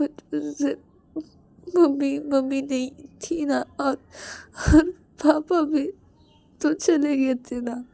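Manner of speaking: high, tearful, stammering confession; crying. Acted. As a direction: act out an orphaned boy choking up. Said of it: "इसलिए तुम रो रहे हो".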